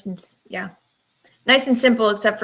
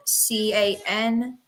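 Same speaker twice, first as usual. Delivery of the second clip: slow, careful spelling; spelled out slowly; slower than usual. Shown as a demonstration. Guest spelling her email for listeners, slow and careful. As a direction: spell each letter slowly and clearly. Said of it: "I can repeat my email one more time".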